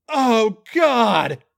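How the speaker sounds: loud, high groan. Acted. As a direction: groan out the climax. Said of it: "he groaned".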